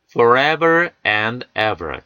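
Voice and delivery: forever and ever drawn out slowly; very slow model reading. Shown as a demonstration. Teacher modelling forever and ever slowly. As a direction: stretch it out for learners. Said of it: "참 고려할 부분이 많은 단어입니다".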